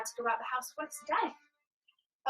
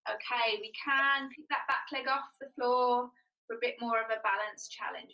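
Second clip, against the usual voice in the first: ordinary talk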